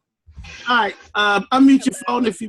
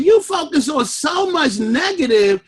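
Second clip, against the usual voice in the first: usual speaking voice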